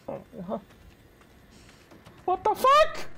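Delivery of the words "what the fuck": very high, loud shocked cry; startled out of his wits; shocked shriek. Real, not acted. Sudden scare in game.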